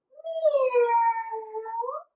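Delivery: high, rising, drawn-out meow; playful cat meow for the kids. Pretend. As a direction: squeak out a high kitty meow. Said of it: "I'm gonna make the noises".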